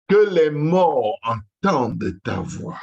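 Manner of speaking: real impassioned prayer shout; crying out in prayer. Real, not acted. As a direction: call it out at full force, pitch soaring then falling. Climax of fervent prayer.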